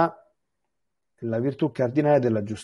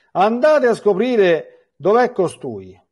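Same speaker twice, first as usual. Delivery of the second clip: much higher, louder, slow royal order; king's command; 9 semitones higher, 6 dB louder, slower than usual. Acted. The king of Aram ordering his officers to find Elisha, commanding.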